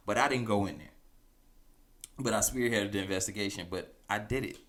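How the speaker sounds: normal talking voice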